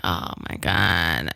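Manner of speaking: low, slow, drawn-out oh my God of dread; dismayed groan. Retold or imitated. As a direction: sink into it like bad news just landed. Learns audition includes writing English.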